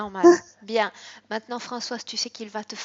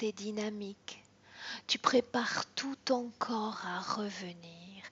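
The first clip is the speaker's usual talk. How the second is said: whispered hypnosis; 4 dB louder than usual. Acted. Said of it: "counting client out of trance".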